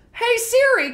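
usual speaking voice

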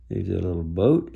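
usual speaking voice

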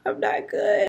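a high, pitiful mock whimper; mock distressed. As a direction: whimper it, playing up the despair. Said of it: "I'm just like".

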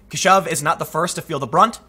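usual speaking voice

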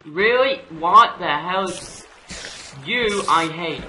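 loud, high indignant disbelief; exasperated outburst. Real, not acted. Gamer attacked by an enemy, exasperated outburst. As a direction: protest in annoyed disbelief.

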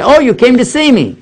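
high, pleased greeting; a confused old man delighted to see his son; forgetful father. Retold or imitated. Father greeting his son entering the room, high and pleased. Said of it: "he would say".

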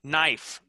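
"Knife" said as nife; "Knife" with no K sound; silent K. Shown as a demonstration. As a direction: start "Knife" on the N. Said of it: "That's right".

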